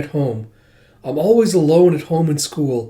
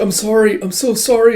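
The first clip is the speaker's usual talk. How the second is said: frantic apology; 9 semitones higher, 4 dB louder than usual. Retold or imitated. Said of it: "He's like".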